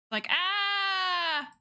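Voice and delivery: short high mock scream of a fleeing stick man; cartoon scream. Streamer voicing her stick figure fleeing a shark, mock scream. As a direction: yelp like the runner being chased.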